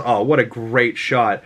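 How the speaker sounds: usual speaking voice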